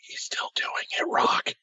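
unvoiced aside whisper. Real, not acted. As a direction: whisper it under your breath. Aside mid-banter.

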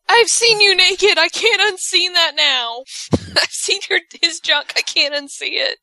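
high, hammed-up dismay with a laugh; mock horrified photographer. Photographer who saw too much, mock horrified. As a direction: wail it as the scarred onlooker. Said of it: "the photographer's like".

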